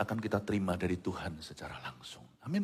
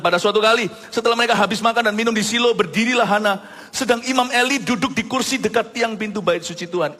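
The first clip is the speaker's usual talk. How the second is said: loud, high, brisk Bible reading; scripture reading; 10 semitones higher, 8 dB louder than usual. Acted. Pastor reading the Bible passage aloud to the congregation. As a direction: proclaim the verse to the church.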